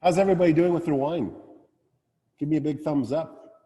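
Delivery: rally the room with energy; calling out. Real, not acted. Host calling out to tasting crowd.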